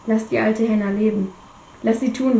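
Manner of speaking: ordinary delivery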